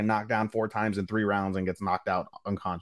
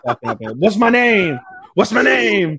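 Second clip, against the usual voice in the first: slow, high shouted taunt; hammed-up ring taunt; mock fighter taunt; 10 semitones higher, 8 dB louder, much slower than usual. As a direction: bellow it like a gloating fighter.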